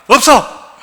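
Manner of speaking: curt gruff father's no; blunt father. Retold or imitated. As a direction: cut him off flatly like a stern father. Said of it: "무뚝뚝한 아버지".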